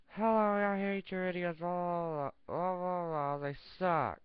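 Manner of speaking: high puppet voice, slowing at the end; a snide toy chicken sneering; lightly voiced rude puppet; Mister Chicken voice. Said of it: "This is Mister Chicken".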